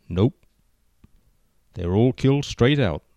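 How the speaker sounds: plain reading voice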